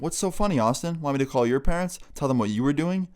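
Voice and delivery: high, threatening mom voice; confrontational mom. Retold or imitated. Mom threatening to call laughing boy's parents, confrontational mom. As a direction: challenge him like an annoyed mom.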